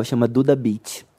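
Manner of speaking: usual speaking voice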